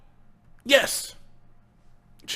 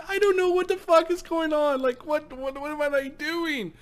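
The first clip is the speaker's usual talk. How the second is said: high, lost voice of the baffled presenter; imitating the presenter's confusion; confused presenter; 11 semitones higher than usual. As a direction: play the man who has no idea what's happening. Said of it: "He's like".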